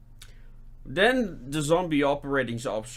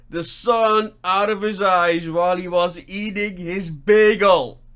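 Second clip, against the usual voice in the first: mock awe; 6 semitones higher, 7 dB louder than usual. Teller marvelling at the officer's lazy genius, loud and high. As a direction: marvel louder and higher until the awe bursts out.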